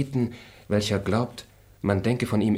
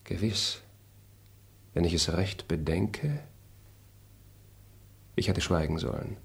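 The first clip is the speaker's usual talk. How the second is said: softer, lower musing aside; quiet soliloquy; 4 semitones lower, 6 dB quieter than usual. Acted. Traveller alone regretting his suspicion, quiet monologue. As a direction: think it through to yourself.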